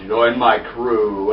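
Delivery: low, very slow villain voice; mock sinister leader. Bald guy recruits a crew, mock ominous.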